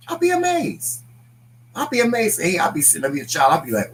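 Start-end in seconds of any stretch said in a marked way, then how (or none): 0.1-2.4 much higher, squealed in wonder; amazed. Real, not acted. Wife marvels at husband lecturing doctors, high amazed. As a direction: let the amazement pop up high.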